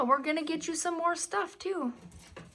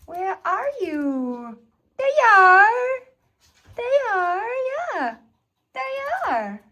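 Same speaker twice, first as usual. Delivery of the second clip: high excited squeaky pet voice; talking to a dog; 6 semitones higher, 10 dB louder, slower than usual. Real, not acted.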